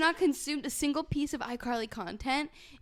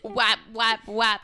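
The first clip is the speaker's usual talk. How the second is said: put-on baby lisp; 3 semitones lower, 5 dB louder, slower than usual. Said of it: "waps like a baby".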